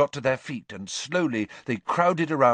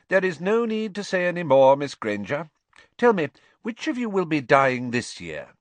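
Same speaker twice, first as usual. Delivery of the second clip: high, brisk, knowing teacher; crisp professor voice, firm and unsurprised; stern schoolmistress; 7 semitones higher, 5 dB louder than usual. Acted. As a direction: cut the pupil off, then ask drily.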